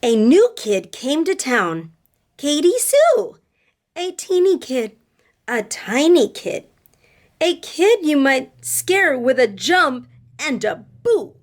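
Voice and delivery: slow, sing-song read-aloud; reading to children. A narrator's reading. Teacher reading a picture book to her class, sing-song. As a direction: read it with rhythm for little listeners.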